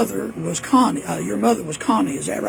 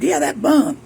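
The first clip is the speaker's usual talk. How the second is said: imitating mama; 9 semitones higher, 6 dB louder than usual. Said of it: "She said".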